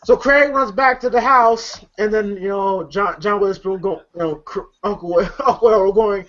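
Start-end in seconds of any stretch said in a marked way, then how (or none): none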